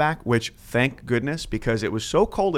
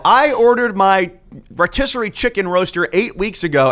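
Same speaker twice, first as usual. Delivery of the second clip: higher, louder indignant caller; angry caller; 8 semitones higher, 5 dB louder than usual. Retold or imitated. Caller complains to call center, higher indignant. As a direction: complain like a cheated customer. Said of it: "people would call in and go".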